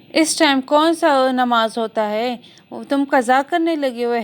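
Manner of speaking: usual speaking voice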